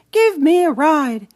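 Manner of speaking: high, loud plea for a ride; calling request. Acted. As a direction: ask the wind to carry the gate. Child asking wind.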